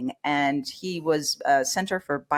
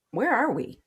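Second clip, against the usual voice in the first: confused disorientation; 7 semitones higher than usual. Retold or imitated. Disoriented waking in the mall.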